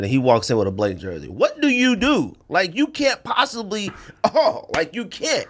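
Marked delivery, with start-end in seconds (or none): 1.3-5.5 high-pitched, exasperated disbelief; incredulous exasperation. Real, not acted. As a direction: throw it up high, totally baffled. Baffled rhetorical question about blank jersey.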